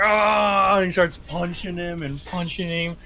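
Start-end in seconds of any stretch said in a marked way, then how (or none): 0.0-0.8 loud, high drawn-out roar; acted berserk "aah"; battle yell. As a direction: roar like a raging fighter. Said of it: "and he's like".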